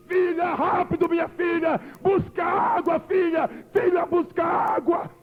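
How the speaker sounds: high shouted cry for water; desperate plea. Retold or imitated. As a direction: beg the daughter frantically. Dying woman begs for water.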